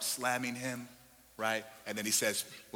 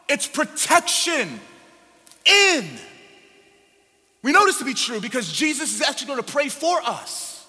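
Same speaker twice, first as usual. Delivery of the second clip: louder, higher, building sermon cry; full-force preaching; 11 semitones higher, 9 dB louder than usual. Acted. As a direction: preach it out with rising fire.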